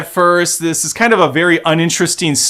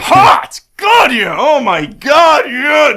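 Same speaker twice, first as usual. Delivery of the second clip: excited whooping; 9 semitones higher, slower than usual. Real, not acted. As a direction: whoop like a fan. Gushing over the actress.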